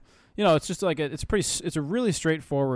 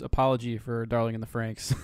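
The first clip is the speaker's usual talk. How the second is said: ordinary delivery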